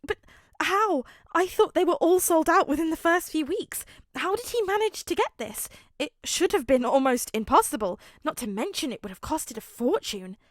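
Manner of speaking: high, loud rapid fan babble; excited gushing. A narrator's reading. Midoriya marvelling at the rare sweater, high and excited. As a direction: gush in disbelief. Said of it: "vibrated with excitement".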